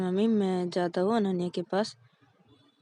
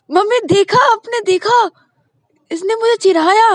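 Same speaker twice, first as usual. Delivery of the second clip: much higher and louder, indignant; shrill complaint; 14 semitones higher, 14 dB louder than usual. Acted. Ananya telling mom Payal teased her, high loud indignant. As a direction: point it out to mom, outraged.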